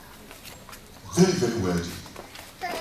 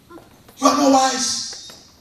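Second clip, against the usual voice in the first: very slow, each word landed, NOT raised high; slow emphatic verdict; 11 semitones higher, 5 dB louder, much slower than usual. Acted. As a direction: stretch it out slowly, hit NOT high and hard. Punchline of preached point.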